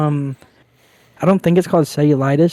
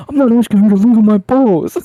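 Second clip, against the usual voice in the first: put-on higher, louder indignant kid voice; imitating little brother; 7 semitones higher, 6 dB louder than usual. Quoting his brother refusing help, higher, louder and indignant. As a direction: protest like an embarrassed kid. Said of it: "And he's like".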